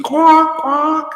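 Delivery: high mocking quacks like a duck. Mocking followers as ducks.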